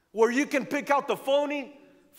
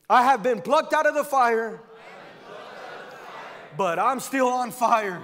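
said like you mean it. Shown as a demonstration. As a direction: declare it like you've been through storms. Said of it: "say it like you mean it".

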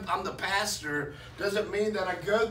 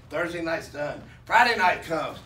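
ordinary delivery

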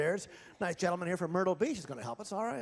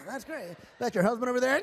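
usual speaking voice

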